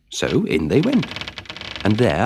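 plain reading voice

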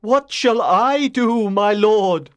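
anguished knight calling out slowly; desperate cry. Acted. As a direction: beg for guidance in despair. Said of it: "he cried in desperation".

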